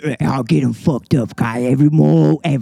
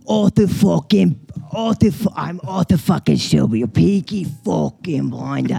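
put-on Peaky Blinders English accent. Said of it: "okay, Peaky Blind".

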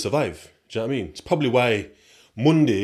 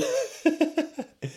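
high-pitched giggle; amused laugh; 3 dB quieter than usual. Real, not acted. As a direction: giggle at your own retelling. Amused at own feigned agreement.

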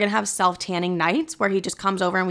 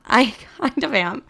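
laughing; 3 semitones higher than usual. Real, not acted.

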